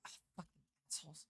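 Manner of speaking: fast, low mutter under the breath; muttered curse. Real, not acted. Streamer cursing at enemies under the breath, fast mutter. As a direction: grumble it to yourself quickly.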